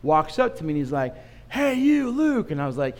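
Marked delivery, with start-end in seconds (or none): 1.5-2.5 high, excited greeting; calling out. Retold or imitated. Man spotting the pastor in a crowd, calling out high. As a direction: call his name across the room.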